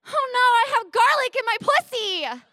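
high, loud mock-horrified wail; horrified realization. Remembering the garlic mid-encounter, panicked. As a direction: cry it out in comic panic.

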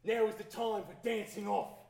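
loud, high dramatic proclamation; booming decree. Acted. Character shouting at the computer, booming proclamation. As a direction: declare it like an unstoppable command. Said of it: "and said".

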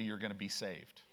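ordinary delivery

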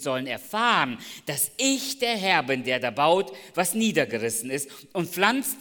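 loud, ringing scripture reading; booming sermon delivery; preaching scripture. Acted. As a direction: proclaim the verse to the hall. Reads scripture at full force.

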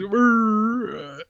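silent, high-pitched grunt; mimicked grunt. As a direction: grunt under your breath like a smirking actor.

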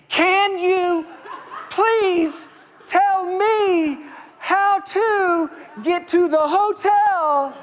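high, loud, slow over-articulation. Retold or imitated. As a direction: shout it slowly so they understand. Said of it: "Talking louder".

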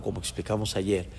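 ordinary delivery

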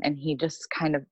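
ordinary talk, nothing marked

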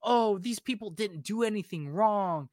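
hammed-up pleading heroine voice; mock naive heroine. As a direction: parody the preachy girl pleading. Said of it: "And she's like".

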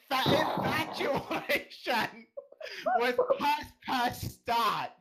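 high, slow, halting fake Australian accent, breaking into laughs; bad Australian impression. Shown as a demonstration. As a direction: struggle through a terrible Australian accent. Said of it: "an impression of Liler doing an impression, an Australian accent".

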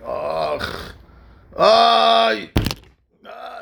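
imitated screaming. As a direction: mimic a man wailing in pain. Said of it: "he brings in is screaming".

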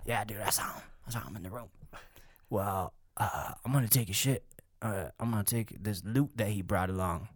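low, soft lazy guard chatting to his buddy. Retold or imitated. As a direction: play a bored guard muttering to a friend. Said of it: "and he's like".

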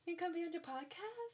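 high sing-song pet voice; talking to a dog. Real, not acted. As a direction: coo at the dog.

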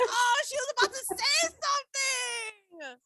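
high, rising cry of frustrated excitement; retold outburst. Viewer reacting as she was about to speak, excited outburst. Re-enacts shouted reaction to interruption.

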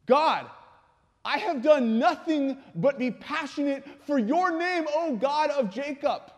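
high, loud plea to God, fading at the end; impassioned, pleading protest; anguished outcry. Acted. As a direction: cry out to heaven in indignant desperation. Preacher acting out Saul crying to God.

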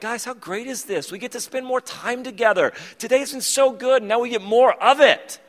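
cheery friend. Retold or imitated. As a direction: bounce it like the annoyingly positive friend. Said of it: "Zach's response was like".

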